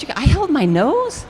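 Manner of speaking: a hint of amazed disbelief. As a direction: she can't believe she held her nose. Stunned at mother's revelation.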